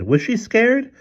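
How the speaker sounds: very high-pitched question; mock surprise. A narrator's reading. Father answering his stern daughter, in high mock surprise. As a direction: ask it high, as if astonished.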